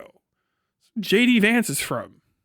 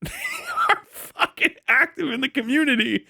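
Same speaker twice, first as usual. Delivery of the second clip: laughing; 8 semitones higher than usual. Real, not acted. Cracking up about active Karens.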